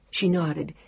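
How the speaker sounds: usual speaking voice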